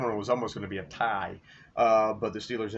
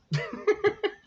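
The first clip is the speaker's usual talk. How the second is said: laugh. Real, not acted. Happy about his team's quarterback.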